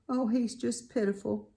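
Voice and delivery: usual speaking voice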